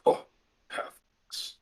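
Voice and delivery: muttered low and unvoiced; scornful breathy aside, barely audible; under his breath. Orc prisoner mutters to the half-orc, under his breath. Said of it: "he says under his breath".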